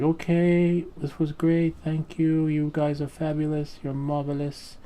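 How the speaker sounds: high-pitched mock showbiz gushing; drawn-out, high, hammed-up flattery; gushing send-off. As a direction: imitate a production crew laying on the praise. Crew gushing goodbye at shoot's end.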